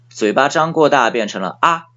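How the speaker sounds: ordinary talk, nothing marked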